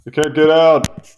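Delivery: loud, high wail of being trapped; exasperated yell; frustrated shout. Real, not acted. Stuck in picture, cries out.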